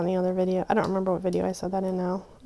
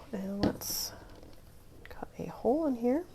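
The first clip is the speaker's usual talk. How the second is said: whispering; 2 semitones higher, 8 dB quieter than usual. Real, not acted. Whispering while crafting.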